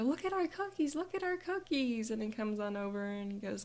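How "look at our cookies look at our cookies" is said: put-on higher, eager child voice showing off the cookies; a proud kid tugging at dad, pitched up high; excited little girl.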